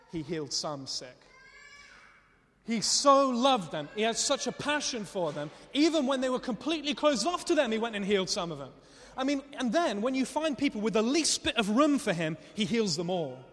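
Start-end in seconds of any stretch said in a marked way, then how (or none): 2.7-13.4 loud, raised preacher's delivery; high, forceful, emphatic proclamation; impassioned preaching. Real, not acted.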